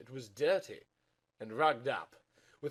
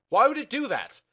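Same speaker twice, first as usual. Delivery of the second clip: incredulous; 12 semitones higher, 11 dB louder than usual. Acted. Incredulous high voice.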